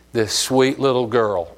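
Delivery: ordinary talk, nothing marked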